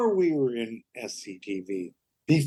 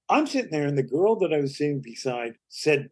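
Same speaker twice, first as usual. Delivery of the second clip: ordinary talk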